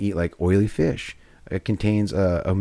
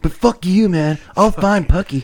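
hammed-up rude brush-off; mock rude stoner; 6 semitones higher, slower than usual. Voicing the rude owner.

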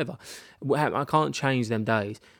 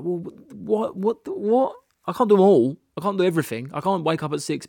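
high-pitched, overwhelmed comeback; incredulous and fed up, voice pitched up; exasperated protest; 6 semitones higher, 4 dB louder than usual. Retold or imitated. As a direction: act out throwing up your hands at all the advice. Acting out overwhelmed reply to advice.

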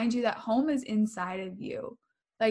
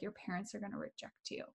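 much softer, imitating a fearful inner voice; scared inner voice; 17 dB quieter than usual. Scared part of her warns about telling parents, hushed fearful aside. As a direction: drop right down for the mock-scared thought. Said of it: "that scared part of me".

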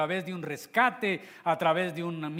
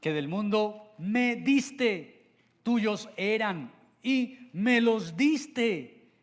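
slow, louder reading that presses on diste; emphatic slow reading; 6 semitones higher, 4 dB louder, much slower than usual. Shown as a demonstration. Preacher reading John seventeen, emphasizing the repeated word. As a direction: read it slowly and weigh each word. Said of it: "enfatizando una palabra".

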